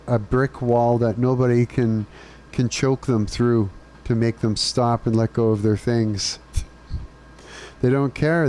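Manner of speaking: ordinary delivery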